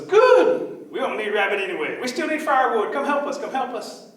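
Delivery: high, loud, eager little person; disguised rabbit. Acted. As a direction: squeal with relief, then urge help.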